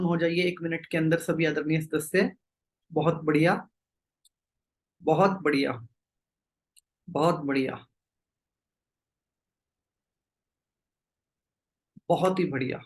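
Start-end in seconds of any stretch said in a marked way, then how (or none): 2.9-12.8 low, unhurried meditation-guide voice with long pauses; spaced-out, lowered, calming instructions; guided meditation. Real, not acted. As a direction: settle the group gently, leaving long silences. Leads group into meditation.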